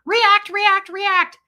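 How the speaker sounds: high, loud shrieking rant; hammed-up screechy outburst; Marilyn. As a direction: scream each phrase like a hysterical imitation. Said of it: "how Marilyn comes on".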